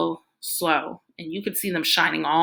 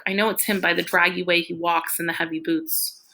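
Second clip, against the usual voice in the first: plain reading voice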